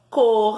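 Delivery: "Cor" loud, with a long rasped final R; R kept in syllable. Shown as a demonstration. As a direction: hold the R at the end of "Cor". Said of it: "Olha o R aqui junto".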